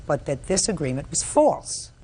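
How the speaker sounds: ordinary talk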